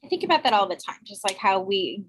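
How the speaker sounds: ordinary talk, nothing marked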